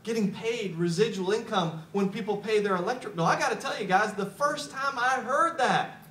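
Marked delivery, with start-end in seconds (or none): none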